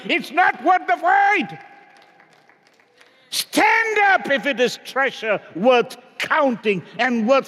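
rally the congregation with a cry; shouted preaching. Acted. Climax of sermon, shouting appeals.

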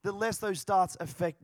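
loud, high preaching, building in fervour; full-force preaching. Real, not acted. Preacher proclaims Jesus as healer to congregation, loud fervent preaching. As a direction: swell into a slow, shouted proclamation.

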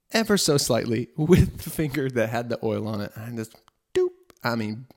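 ordinary talk, nothing marked

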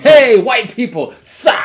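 loud, high, drawn-out shout; mock cheer. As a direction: holler it out like a hype man.

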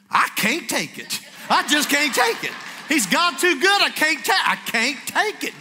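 overwhelmed exclaiming. Retold or imitated. Driver overcome hearing Benny Hinn, exclaiming loudly. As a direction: burst out, overcome with joy.

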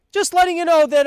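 mocking the hotel sign; mocking sing-song. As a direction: sneer it back sweetly. Mocking the sign's tone.

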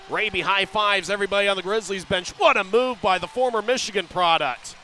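quick steady play-by-play; rapid hockey play-by-play. Real, not acted. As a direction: call the action as it happens. Live play-by-play.

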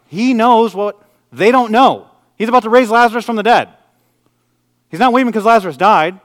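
emphatic high-pitched proclamation; impassioned preaching. Acted. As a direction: press the point on the congregation with force. Preaches at full force.

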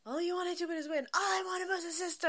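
high, plaintive film-quote voice; retold sisters' quarrel. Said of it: "when they're all like".

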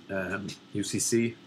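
ordinary talk, nothing marked

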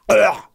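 loud, high gagging grunt; abrupt loud "ugh"; mock retch. Mocking a guitar shoved down the throat, loud gag.

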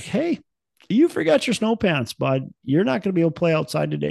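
high, light mom voice, quick at the end; wife's voice. Retold or imitated. Dad imitating his wife telling their son he forgot his snow pants. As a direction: chide the kid gently as mom. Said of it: "My wife is like".

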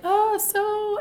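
put-on high-pitched Valley girl lilt; Valley girl accent. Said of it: "I give them a Valley girl accent".

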